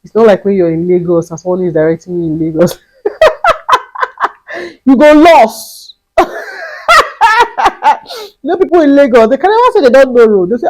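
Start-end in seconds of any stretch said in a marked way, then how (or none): 2.8-9.7 laughing. Real, not acted. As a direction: crack up at the memory. Laughing about Lagos directions.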